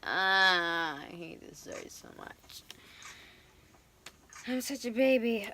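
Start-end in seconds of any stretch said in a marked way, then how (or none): 1.0-2.6 whispered grumble; breathy whispered complaint; whispering. Real, not acted. Frustrated tarot reading aside.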